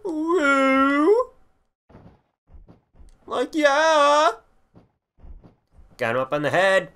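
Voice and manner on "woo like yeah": squealing woo, way up high; high excited cheer. Real, not acted. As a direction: yelp it out in glee. Hyped cheer after wrestling move.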